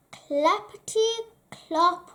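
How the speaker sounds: plain reading voice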